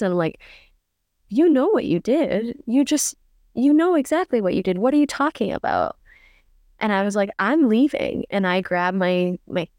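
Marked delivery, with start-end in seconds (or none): 1.3-6.0 indignant retort, higher pitched; pissed off. Retold or imitated. Woman confronting her date, kinda pissed. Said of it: "kinda pissed". 7.4-8.2 determined. Retold or imitated. Angry exit in retold quarrel.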